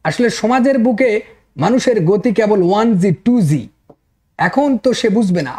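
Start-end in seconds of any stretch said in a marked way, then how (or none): none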